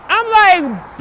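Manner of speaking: ordinary talk, nothing marked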